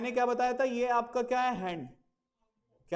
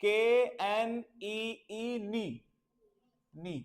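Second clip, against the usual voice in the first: very slow letter-by-letter spelling; teacher spelling K-N-E-E very slowly; much slower than usual. Shown as a demonstration. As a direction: spell each letter out deliberately. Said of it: "घुटने को हम नी बोलते हैं".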